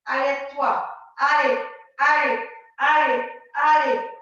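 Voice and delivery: loud, repeated coaching push; urging on. Real, not acted. Coach spurring the class through the exercise, loud urging. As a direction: drive them on with each loud word.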